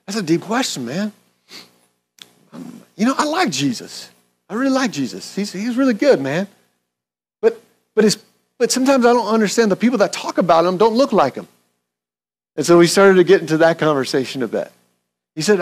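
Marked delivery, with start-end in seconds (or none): 0.1-11.5 put-on Southern accent. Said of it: "He's got a real Southern accent".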